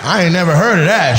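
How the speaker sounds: ordinary talk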